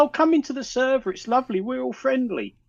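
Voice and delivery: mock friendly invitation. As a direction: imitate a too-friendly host luring people in. Mocking the gang's inviting sales pitch.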